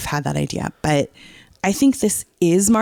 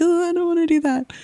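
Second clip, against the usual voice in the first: high, whiny mock reluctance; reluctant self; 12 semitones higher than usual. She balks at meeting fans in person, high and whiny. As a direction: whine it, pitched way up, dreading the meetup. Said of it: "And I'm like".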